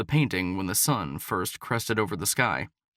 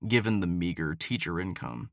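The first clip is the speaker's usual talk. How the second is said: ordinary delivery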